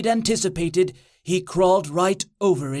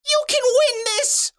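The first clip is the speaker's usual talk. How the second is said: very high falsetto cheer; squealed high cheer; cheerleader's scream. A narrator's reading. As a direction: scream encouragement in falsetto from the sidelines. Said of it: "she screamed".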